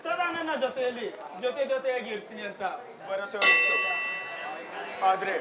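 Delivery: soft, low-voiced mourning for a dead wife; grieving lament. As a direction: murmur it like a grieving husband. Widower mourns his dead wife. (ಸತ್ತ ಹೆಂಡತಿಗಾಗಿ ಮೃದುವಾಗಿ ದುಃಖಿಸುವ ಗಂಡ)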